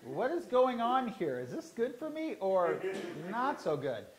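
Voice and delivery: high, loud and slow, laughing through the question; mock bewildered self-questioning. To the class, laughing mock confusion. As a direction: ham up the puzzled inner voice with a laugh.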